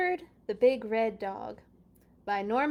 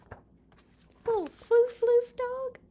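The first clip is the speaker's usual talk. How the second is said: put-on much higher, slow, teasing baby voice; mock cutesy voice; 8 dB louder, much slower than usual. Poking fun at the little show dog, mock cutesy coo. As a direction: coo it like mocking a fluffy lapdog. Mocking a small dog picture.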